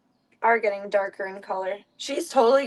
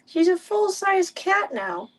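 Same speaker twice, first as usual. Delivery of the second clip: much higher, slower cooing; pet talk; 11 semitones higher, slower than usual. Real, not acted. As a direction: gush over the cat in a high coo.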